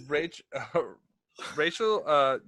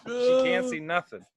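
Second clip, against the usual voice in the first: high, loud insistence; insistent shout; 13 semitones higher, 6 dB louder than usual. Acted. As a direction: push the point, louder. Insisting louder in argument.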